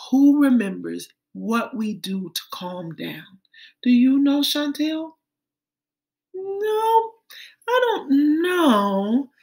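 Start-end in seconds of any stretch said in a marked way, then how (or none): 6.3-9.3 put-on high, unsure small child; little girl voice. Teacher voicing Chantel at a loss, high and small. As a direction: play the child who doesn't know.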